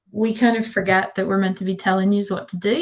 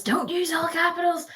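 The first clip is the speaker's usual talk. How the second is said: higher, mild mock yell; mock teacher shout; 9 semitones higher, 4 dB quieter than usual. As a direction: imitate a scolding teacher, half-hearted. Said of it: "Teachers are probably shouting at us".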